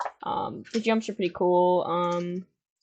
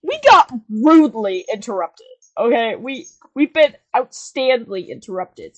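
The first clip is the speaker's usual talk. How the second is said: announcer voice; 3 semitones higher, 12 dB louder than usual. Acted. Mock-formal host.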